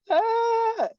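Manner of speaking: very high, loud mock squeal; imitated strained wail. Imitating the gassed trainee's odd noise, high wail. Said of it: "he was making this".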